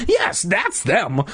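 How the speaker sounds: slow, high, glad call; happy cry. Acted. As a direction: cry it out with joy. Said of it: "I cried happily".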